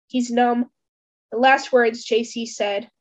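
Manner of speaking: ordinary delivery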